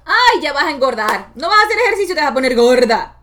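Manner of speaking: high, loud nagging grandmother; scolding inner voice. Retold or imitated. The critical inner voice pressuring her, loud and high. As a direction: hammer her with warnings. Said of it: "yo ejercía presión opuesta".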